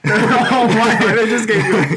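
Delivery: high, loud laugh-through exclamation. Real, not acted. Remembering terrible school lunches, laughing loud. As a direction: crack up in disbelief.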